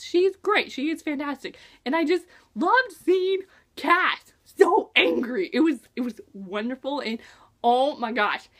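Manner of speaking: usual speaking voice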